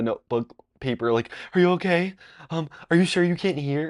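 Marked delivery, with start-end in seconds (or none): 1.5-3.9 high, imitated worried questioning; freaking out. Girl writing back to classmate who can't hear, freaking out. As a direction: mimic a frantic girl checking on someone. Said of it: "she was freaking the fuck out".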